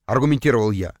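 ordinary delivery, nothing marked

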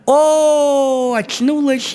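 high, loud startled maiden voice; girl waking up. Retold or imitated. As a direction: squeal it like the girl coming to. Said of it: "Она говорит".

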